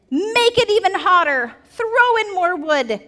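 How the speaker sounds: a hammed-up furious king; mad king. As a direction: rage at the furnace keepers. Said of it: "And he is mad".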